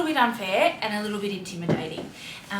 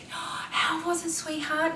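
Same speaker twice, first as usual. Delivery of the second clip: lightly voiced sweet mum, pitched higher; mum asking gently; 8 semitones higher than usual. Mum asks daughter about first school day, sweet higher voice. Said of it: "and I was like".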